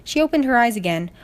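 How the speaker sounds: plain reading voice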